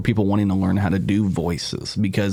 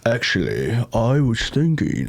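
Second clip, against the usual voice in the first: British accent; slower than usual. Shown as a demonstration. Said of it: "and then you can do".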